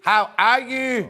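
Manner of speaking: Northern Irish accent, slow and loud. Shown as a demonstration. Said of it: "That's my Northern Irish accent".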